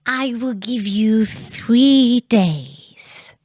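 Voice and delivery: lightly voiced little man, slow and drawn out; slow sly mannikin. A narrator's reading. The imp to the queen, slowly bargaining. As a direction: draw out the offer like a sly imp. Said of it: "said he".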